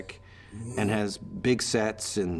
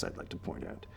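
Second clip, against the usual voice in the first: much softer, whispered aside; muttered aside; 10 semitones lower, 15 dB quieter than usual. Real, not acted. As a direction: drop it in as a hushed side note. Aside about press reports.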